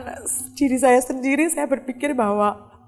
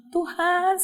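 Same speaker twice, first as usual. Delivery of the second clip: retold cry out; 7 semitones higher than usual. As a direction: call out to God in pain, held back. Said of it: "saya sampai teriak-teriak". (seruan kesakitan yang ditirukan)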